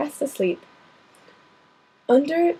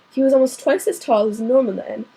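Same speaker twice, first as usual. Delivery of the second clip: ordinary delivery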